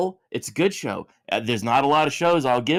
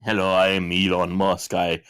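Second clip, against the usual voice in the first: low Elon Musk send-up; mock Elon Musk, low-voiced; Elon Musk impression; 6 semitones lower than usual. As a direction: play Elon Musk, pitched well down. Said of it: "Are you the Elon Musk?".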